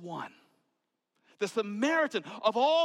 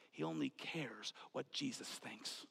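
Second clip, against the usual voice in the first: much softer whisper; 5 semitones lower, 16 dB quieter than usual. Acted. Preacher to congregation, whispering the point. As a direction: confide it to the room.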